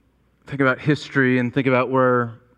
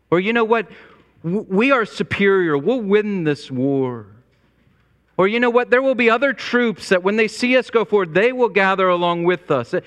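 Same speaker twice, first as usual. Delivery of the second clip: rousing general; 6 semitones higher than usual. Retold or imitated. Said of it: "the reassurance is always something like".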